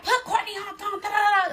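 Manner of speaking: high, loud clamour over the phone; frantic friends. Retold or imitated. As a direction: imitate worked-up friends all yelling at once. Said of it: "They're going crazy".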